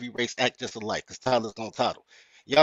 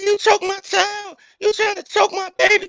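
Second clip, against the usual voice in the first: mock the mother screaming accusations; hammed-up high-pitched hysterical woman; 4 dB louder than usual. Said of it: "I'm like".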